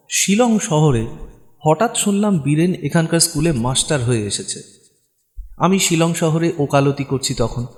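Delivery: ordinary delivery, nothing marked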